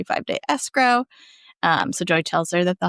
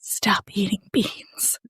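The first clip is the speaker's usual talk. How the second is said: whispered aside; 3 dB quieter, much slower than usual. Pretend. Jokey advice to farting man.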